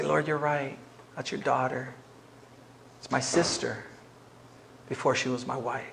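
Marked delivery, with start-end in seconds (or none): 0.0-3.8 low, soft admission; acted meek surrender. Husband yielding to the Lord, low and contrite. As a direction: give in humbly, voice dropped.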